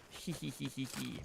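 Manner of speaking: soft villainous giggle; tiny evil tee-hee; cartoon laugh. Giggle after murder.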